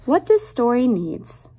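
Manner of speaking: lilting picture-book read-aloud; reading to children. Acted. Teacher reading a rhyming book to her class, lilting read-aloud. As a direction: read the rhyme to little kids, savoring each line.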